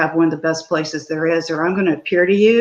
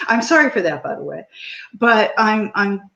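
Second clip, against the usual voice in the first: ordinary talk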